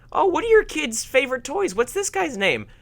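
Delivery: high-pitched mock parent asking about toys; confused parent. Parent quizzing kids about their favorite toys, confused. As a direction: put on a high, puzzled parent voice. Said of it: "imagine how confused parents would be".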